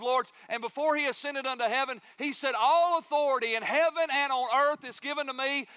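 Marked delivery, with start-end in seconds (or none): 2.6-5.7 high, loud proclamation of authority; preacher's raised, commanding quote; ringing declaration. Acted. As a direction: proclaim it boldly to the whole room. Preacher voices Jesus declaring His authority.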